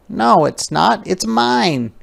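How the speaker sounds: put-on high protesting child voice; defensive girl. Ruthie protests to Martin, high and defensive. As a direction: insist it's yours. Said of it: "Ruthie was startled".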